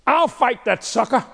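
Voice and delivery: put-on brash, high teen boast; cocky teenager. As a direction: puff up like a kid itching to fight. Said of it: "Ain't that sound like a teenager".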